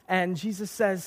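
ordinary talk

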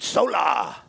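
SOLA said with some attitude; showy, feeling-filled demonstration. Shown as a demonstration. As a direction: give it feeling and swagger. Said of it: "like, with some attitude".

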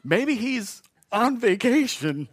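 very slow, drawn-out, stifling laughter; laughing taunt. Retold or imitated. Preacher replaying Elijah's joke, slow and chuckling. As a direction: tease while trying not to laugh. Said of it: "He says".

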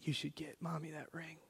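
soft, unvoiced whisper of a little girl; whispering child. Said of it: "she goes".

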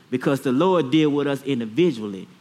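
ordinary delivery

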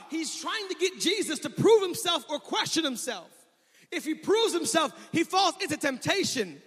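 loud, high, rising sermon delivery; preaching at full force. Acted. Pastor driving the congregation with full-force preaching. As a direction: preach it, pushing hard on every line.

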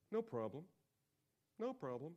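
ordinary talk

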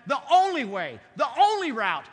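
preacher's closing crescendo; loud preaching. Real, not acted. Preaching climax.

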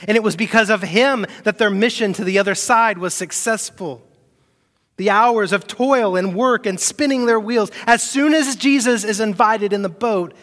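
full-force preaching. Acted. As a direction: drive the point home to the church. Preaching climax.

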